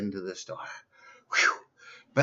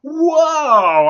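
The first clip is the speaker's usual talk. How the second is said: sudden high yelp of shock, much louder; 14 dB louder than usual. Acted. Peeing on an electric fence, a loud high shocked yelp. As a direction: cry out as the fence jolts you.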